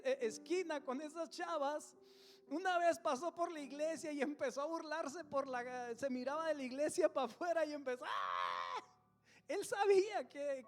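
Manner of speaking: loud, high preaching voice; fiery testimony from the pulpit; preaching at full force. Acted. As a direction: preach the story out to the crowd.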